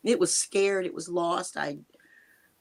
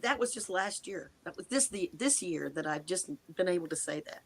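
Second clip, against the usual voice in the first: ordinary talk, nothing marked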